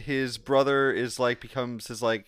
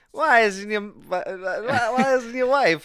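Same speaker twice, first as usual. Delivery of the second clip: put-on Italian accent; 7 semitones higher, much slower than usual. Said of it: "I was trying to do an Italian accent".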